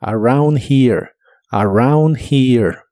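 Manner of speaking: "around here" lento con h suave; slow pronunciation demo. Shown as a demonstration. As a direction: say "Around here" slowly, breathing the h. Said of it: "La H de here se pronuncia como una J suave".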